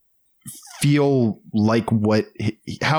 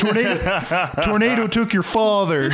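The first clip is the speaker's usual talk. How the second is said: laughing mock spoiler; 9 semitones higher than usual. Joke spoiler about The Father.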